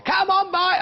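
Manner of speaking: sudden high, loud hammed-up sales yell; mock shout. Shown as a demonstration. Shopping channel host mocks pushy sellers to viewers, a sudden high mock yell. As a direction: belt it like a pushy salesman, tongue in cheek. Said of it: "Don't expect me to scream and shout".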